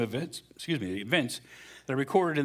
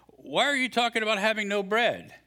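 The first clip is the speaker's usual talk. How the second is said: higher, louder, pointed question; Jesus' voice; 9 semitones higher, 3 dB louder than usual. Acted. Pastor reading Jesus questioning the disciples.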